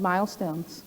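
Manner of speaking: usual speaking voice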